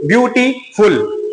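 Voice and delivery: "ब्यूटीफुल" slowly split into ब्यू-टी-फुल; syllable by syllable. Shown as a demonstration. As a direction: stretch "ब्यूटीफुल", pausing before the final फुल. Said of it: "दो या तीन syllable का use होता है". ("ब्यूटीफुल" को धीरे-धीरे अक्षरों में तोड़कर)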